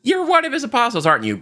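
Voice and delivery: second accuser. Retold or imitated. Another person challenging Peter about the apostles, lightly voiced accuser. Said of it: "Another person".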